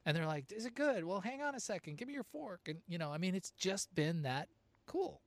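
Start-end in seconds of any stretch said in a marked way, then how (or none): 0.5-2.7 higher, eager imitation of a curious diner; lightly playing a nosy, friendly neighbor at the bar; friendly stranger. Restaurant neighbors asking to taste.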